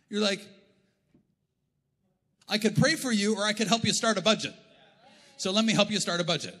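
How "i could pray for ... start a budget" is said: louder, raised, matter-of-fact offer to help; prayer team answering the excuse, louder and firm. Pretend. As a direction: push the budget help firmly. Acted prayer team reply.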